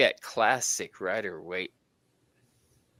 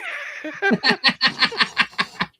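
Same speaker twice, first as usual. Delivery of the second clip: loud, high burst of laughter; real high-pitched cackling laugh; big laugh; 8 dB louder than usual. Real, not acted. Co-host cracking up over what was misheard, loud helpless laugh.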